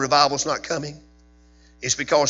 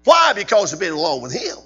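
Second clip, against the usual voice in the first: rising, louder fervent sermon cadence; preaching at full force; 6 semitones higher, 6 dB louder than usual. Acted. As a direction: drive it home like a revival preacher.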